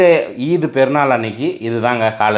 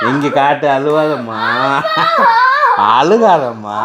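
melodramatic, long-stretched endearments; mock wailing consolation; 4 semitones higher, much slower than usual. Vlogger comforting the family over Eid, hammed-up and wailing. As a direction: plead like an overdone weepy scene.

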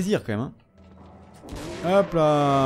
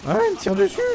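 startled yelp; 3 dB louder, faster than usual. Real, not acted. Gamer shot at mid-match.

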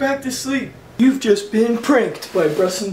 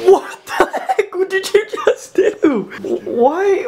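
high-pitched, incredulous outburst; startled protest; 9 semitones higher, slower than usual. Real, not acted. Vlogger to friend who flipped food on his porch, incredulous. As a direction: squeal it in laughing disbelief.

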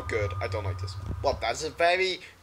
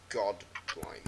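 normal talking voice

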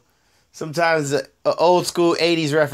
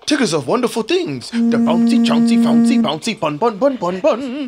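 bouncy, higher cartoon tiger voice; Tigger; 3 semitones higher than usual. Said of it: "I can do a Tigger impersonation".

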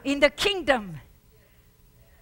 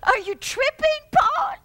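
high, disbelieving challenge; incredulous; 10 semitones higher, 6 dB louder than usual. Acted. Doubter mocking Paul, high and disbelieving. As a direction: scoff at him in disbelief.

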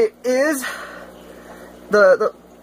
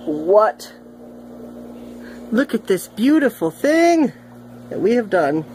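catfish voice. Retold or imitated.

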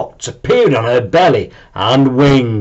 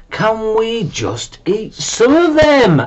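high, slow pleading dragon voice; young dragon voice; 10 semitones higher, slower than usual. A narrator's reading. Bob pleading with Mum, high and slow. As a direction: ask hopefully like a hungry kid.

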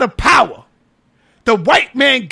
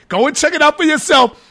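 ordinary talk, nothing marked

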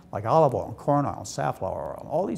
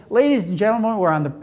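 public address; 5 semitones higher, 5 dB louder, faster than usual. Pretend. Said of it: "they just got up and they said".

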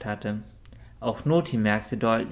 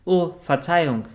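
higher, louder hurried apology; quick apology; 5 semitones higher, 5 dB louder than usual. A narrator's reading. Richard hastily apologizing to Noti in the queue, higher and louder. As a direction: step aside quickly, caught out. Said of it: "quakte Richard schnell".

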